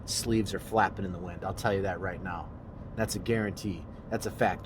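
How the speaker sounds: usual speaking voice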